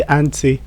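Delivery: "anti" said as AN-tee; British pronunciation. Shown as a demonstration. Teacher advising fans of British English, British "AN-tee" pronunciation. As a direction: end "anti" on an "ee" sound. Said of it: "As a British person".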